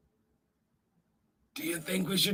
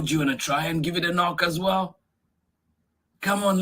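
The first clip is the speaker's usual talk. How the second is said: usual speaking voice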